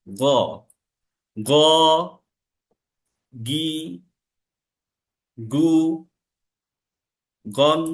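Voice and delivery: "গ, গি, গু, গন, গিন, গুন" each rasped from the upper throat; slow ghain letter drill; ghain syllable drill. Shown as a demonstration. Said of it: "উচ্চারণটা হবে ঠিক আমার গলার উপরের দিক থেকে".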